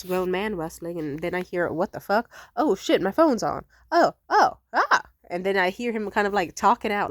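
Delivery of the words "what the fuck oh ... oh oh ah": startled. Retold or imitated. Friend startled, phone still on.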